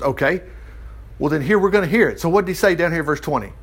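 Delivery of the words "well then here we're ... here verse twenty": ordinary talk, nothing marked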